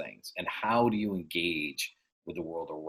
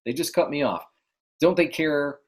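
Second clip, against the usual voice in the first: higher, louder outraged rant at the other driver; worked up driver; 7 semitones higher, 5 dB louder than usual. Retold or imitated. Driver fuming at the car that cut him off, indignant outburst. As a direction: sputter like someone just cut off in traffic. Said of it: "you could get all worked up".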